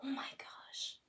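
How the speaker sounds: high, breathless whispered wonder at the cat; excited whisper. Shown as a demonstration. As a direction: whisper it like an amazing discovery. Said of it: "it could be like".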